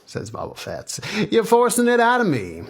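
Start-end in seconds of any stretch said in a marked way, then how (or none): 1.3-2.6 high, loud protest; exasperated surrender. Acted. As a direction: give in under pressure, loudly. Guru gives in under threat.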